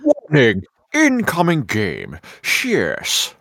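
mock-menacing game announcer; intimidating male voice. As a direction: ham up a threatening male announcement. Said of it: "an intimidating male voice".

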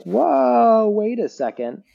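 sudden high, loud whoa then pulled back; alarmed double-take; startled whoa. Pretend. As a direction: jolt like an alarmed nervous system. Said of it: "your system's like".

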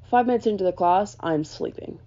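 ordinary talk

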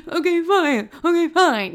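high, laughing imitation of a whiny crybaby; mock crying ex. Mocking ex who gives in, whiny crybaby voice. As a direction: squeak it out like a sniffling baby. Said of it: "when they cry like that".

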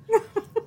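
quick high giggle; high burst of laughter. Real, not acted. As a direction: squeak of a laugh. Laughing mid-story.

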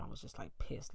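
pissed whispered under the breath. Real, not acted. Confiding her old anger to the audience, in a whisper.

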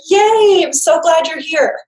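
put-on high, bright cheer of a happy baby; delighted baby. Baby greeting mama on her return, high excited cheer. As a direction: squeal it like a baby thrilled mum's back. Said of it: "they're like".

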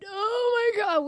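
loud, high-pitched exasperated groan of words; mock dismay. Coworkers overhearing the call on speaker, loud dismay. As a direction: wail it in exaggerated dismay.

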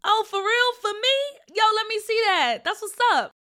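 put-on thrilled kid getting a gift; excited boy. Boy delighted by card.

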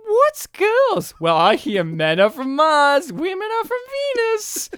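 high, squeaky back-and-forth; toy robot voices. Retold or imitated. Podcaster mimics two robots discussing girls, squeaky. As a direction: pipe it up high like little robots.

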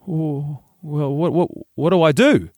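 lightly acted helpless, stammering mother; flustered mum. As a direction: repeat words as if lost for advice. Flustered mum asks son for help.

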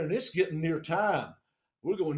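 plain reading voice

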